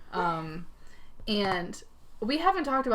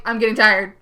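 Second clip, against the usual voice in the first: loud announcement; 11 dB louder than usual. Retold or imitated. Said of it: "So I'm like".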